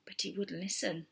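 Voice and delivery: hushed storybook whisper. A narrator's reading. Narrator whispering to the children. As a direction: breathe it like a secret.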